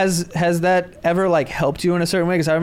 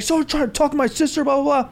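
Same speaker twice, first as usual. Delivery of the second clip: mock screaming; 8 semitones higher, faster than usual.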